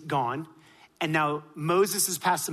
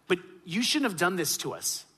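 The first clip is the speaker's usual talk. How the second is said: ordinary talk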